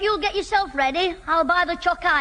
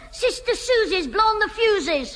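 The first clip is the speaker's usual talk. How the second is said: ordinary talk, nothing marked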